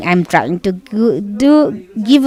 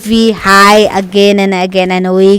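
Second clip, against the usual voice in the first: louder, driving esports commentary; live play-by-play; 4 dB louder than usual. Acted. Caster calling a PUBG Mobile fight to viewers, live play-by-play. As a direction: call the fight like a caster on the mic.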